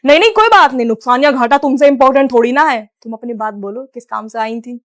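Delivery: doting husband. Acted. Husband indulging wife.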